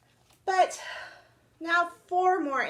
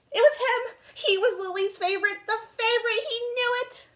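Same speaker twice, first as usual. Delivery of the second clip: high, louder thrilled triumph; triumphant; 13 semitones higher, 4 dB louder than usual. Acted. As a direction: burst out in delighted triumph. Cat triumphant, finally the favorite.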